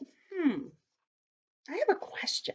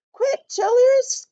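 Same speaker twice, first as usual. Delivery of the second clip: loud, high warning shout; urgent cry; 13 semitones higher, 7 dB louder, slower than usual. A narrator's reading. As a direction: shout to hurry them out of danger. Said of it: "cried Grandude".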